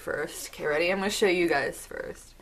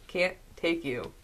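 ordinary talk, nothing marked

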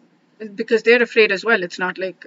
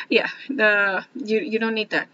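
usual speaking voice

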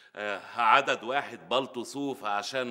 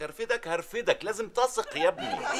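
comic high insistence; 10 semitones higher than usual. Acted. Comic escalating pleading scene.

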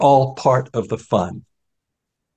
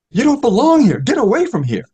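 high, loud, strained order to leave; the blind beggar orders Newman out, high and harsh; hostile blind beggar; 11 semitones higher, 4 dB louder than usual. Acted. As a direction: shove the intruder away with your voice.

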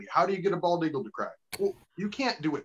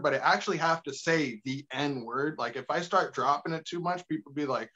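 ordinary delivery

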